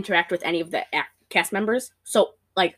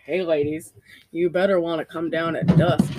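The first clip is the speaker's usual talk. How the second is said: flat low ranger drone; monotone voice; 5 semitones lower than usual. Retold or imitated. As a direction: drone it with no life. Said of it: "flat monotone voice".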